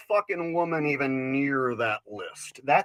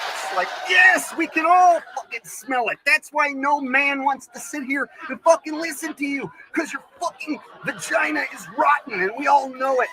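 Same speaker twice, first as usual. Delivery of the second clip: loud, high angry rant; ranting shout; 9 semitones higher, 4 dB louder than usual. Acted. Comic shouting at female comics. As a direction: yell it in outrage.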